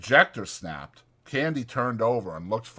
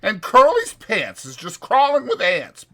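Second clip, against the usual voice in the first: higher, louder rough ranch hand; 12 semitones higher, 6 dB louder than usual. Acted. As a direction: speak it like a bunkhouse ranch hand. Narrator voicing ranch hand.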